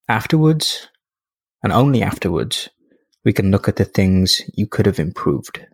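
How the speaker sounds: unhurried guided reflection, leaving space after each phrase; guided meditation. Acted. Podcast host guiding listeners through end-of-day review, calm meditation voice.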